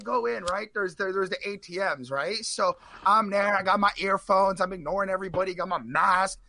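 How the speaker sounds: usual speaking voice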